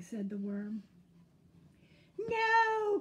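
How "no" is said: long, high, loud wail; crying out. A narrator's reading. Skunk loses again, high loud cry. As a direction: belt out the defeat. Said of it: "cried Skunk".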